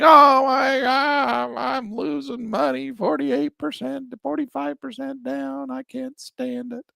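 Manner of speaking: high, slow mock wail over losing money; mock crying the blues. Investor fakes panic over falling stock, high slow mock wail. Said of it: "I'm crying the blues".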